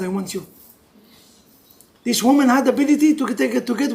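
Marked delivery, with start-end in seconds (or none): 0.0-0.4 low, hushed declaration; quiet prayer. Acted. Worshipper telling God what they want, quiet prayer. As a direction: finish low and soft, intimately.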